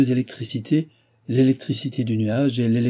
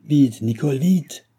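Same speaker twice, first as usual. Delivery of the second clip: lightly acted urgent call, pitched up; raised, mildly quavering shout; quavering old man's cry; 5 semitones higher, 4 dB louder, slower than usual. A narrator's reading. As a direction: hurry her along as an old servant. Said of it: "cria la voix chevrotante du vieillard".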